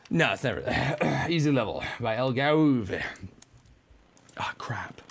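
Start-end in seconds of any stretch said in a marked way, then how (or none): 4.4-5.0 soft whispered curse under the breath; whispered crap. Real, not acted. Muttering after failing.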